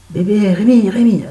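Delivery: high insistent call to wake him. Retold or imitated. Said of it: "Et donc je lui dis". (appel insistant pour réveiller Rémi)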